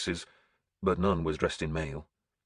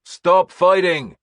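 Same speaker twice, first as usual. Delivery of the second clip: loud, much higher, slow commanding shout; 12 semitones higher, 11 dB louder, slower than usual. A narrator's reading. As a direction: stretch each word out over the fight. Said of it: "Finan echoed my shout".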